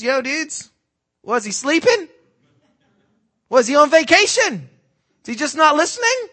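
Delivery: high, loud mocking jeer at the false prophets; sarcastic taunt. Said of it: "I love Elijah's sarcasm here".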